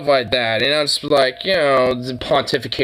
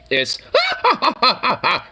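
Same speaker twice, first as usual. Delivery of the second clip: high, cackling witch laugh; witchy laugh; 12 semitones higher than usual. Retold or imitated. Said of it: "it almost sounds like a witch".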